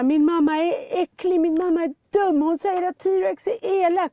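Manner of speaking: mother voicing son's rant in the supermarket; mock raging child. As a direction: imitate a furious kid shouting in a shop. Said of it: "helt liksom rabiat".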